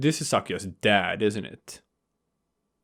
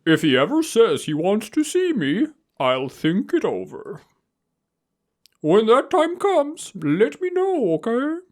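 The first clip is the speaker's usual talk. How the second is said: high, affected character voice climbing even higher; Yuya; 11 semitones higher, 3 dB louder than usual. Acted. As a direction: pitch it way up and stretch the last phrases. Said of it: "Sakuya's dad has Yuya's voice".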